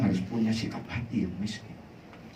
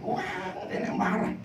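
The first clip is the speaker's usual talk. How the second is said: ordinary delivery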